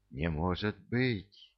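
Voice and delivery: slow, softened whisper of disbelief. A narrator's reading. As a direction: drop to a stunned hush, drawn out. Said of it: "прошептала она". (потрясённый тихий шёпот)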